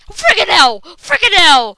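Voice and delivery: high, very loud yell; real startled screaming; panicked shout. Real, not acted. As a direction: yelp it out under sudden attack. Panicking as zombies attack.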